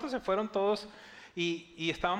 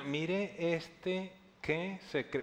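slow, softer, sneering mutter on "Mire este que se cree"; scornful inner mutter; 4 dB quieter, much slower than usual. Acted. Teachers of the law scoffing at Jesus in their thoughts, slow and softer. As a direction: scoff under your breath, drawn out. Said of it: "pensando, ¿no?".

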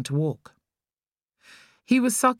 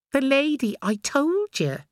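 put-on child's voice; 10 semitones higher than usual. A narrator's reading. Boy brushing off his mother, impatient. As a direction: drag it out like an impatient kid.

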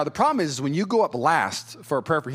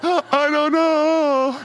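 very slow, much higher, sobbing; weeping; 12 semitones higher, 6 dB louder, much slower than usual. Retold or imitated. Answering puzzled friends, very slow high sobbing. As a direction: stretch it out through sobs. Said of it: "just absolutely weeping".

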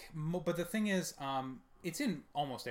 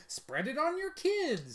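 high, loud, drawn-out hawking; mock sales pitch; 13 semitones higher, 5 dB louder, slower than usual. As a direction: pitch it like an over-cheery seller. Mock talcum powder sales pitch.

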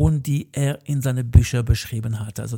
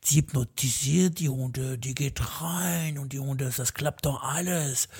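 softer, hushed hypnotist tone; mock mystical hush; 7 dB quieter than usual. Mocking a trainer who hypnotizes dogs, hushed and softer.

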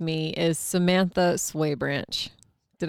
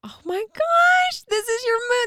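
high, slow, gushing squeal of delight; thrilled squeal. Real, not acted. Host squealing at her guest being a Leo, gushing. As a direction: gush over her like it's the best news ever.

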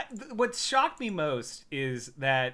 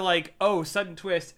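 normal talking voice

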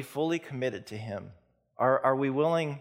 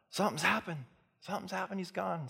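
urgent; 8 semitones higher, 3 dB quieter than usual. Acted. Mary tells the disciples.

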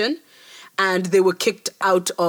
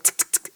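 whispered tick tick of keys; unvoiced clicking imitation; soft mock typing sounds; typing noise; 7 dB quieter than usual.

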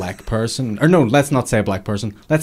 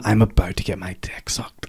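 excited whisper, imitated; 2 semitones lower, 7 dB quieter than usual. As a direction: whisper it like an eager secret. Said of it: "he was so excited".

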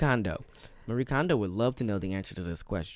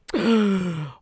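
gasp; 9 semitones higher, 5 dB louder than usual. Acted. Mock shock at question.

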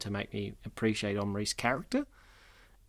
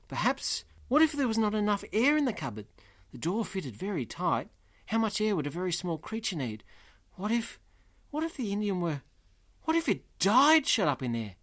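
acted rising worry, higher pitch; higher-pitched, mildly panicked storybook reading; distressed; 8 semitones higher than usual. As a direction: read it as anxious what-ifs piling up. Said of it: "He's very distressed at that point".

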